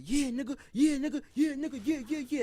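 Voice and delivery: high, pumped chant of yeah; cheering himself on mid-gym; hyped flexing. Retold or imitated. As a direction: soak up the crowd's cheers. Soaking up praise while dragged out.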